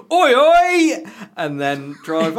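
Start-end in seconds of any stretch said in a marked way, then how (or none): 0.1-1.0 loud, high Essex workman shout; Essex accent. Retold or imitated. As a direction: bellow it like an Essex builder. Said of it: "say in my best Essex accent".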